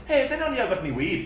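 lightly voiced stoner ask; a hint of a needy stoner; imitating Legacy. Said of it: "He goes".

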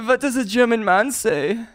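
put-on German-accented quiz question; playful German quizmaster; mock German accent. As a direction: set up the joke in a comic German voice.

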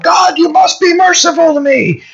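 high-pitched mock cry of demand; mock demanding shout. A sinner demanding mercy of God, mock demanding shout.

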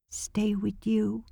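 put-on slow, whispered child's plea; little boy whispering to his sister; very quietly. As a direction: whisper it, small and pleading. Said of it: "he said very quietly".

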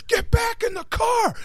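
high, slow barked order; stern cop order. Retold or imitated. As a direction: command him back into the car. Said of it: "the cop sits there and goes".

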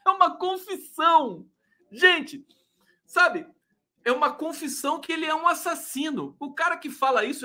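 high, indignant exclaim; outraged. Real, not acted. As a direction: exclaim it in disbelief. Outraged at the confession.

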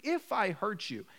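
imitating a bad apology; mock excuse apology. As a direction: drag out a half-hearted excuse. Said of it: "You avoid if, but, and maybe".

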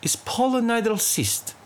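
a hint of an Indian doctor's accent. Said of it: "His accent slipped into Russian".

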